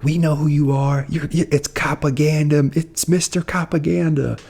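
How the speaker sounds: high-pitched imitation of a heckler; mock accusation. Woman heckling the officer at the scene, imitated accusation.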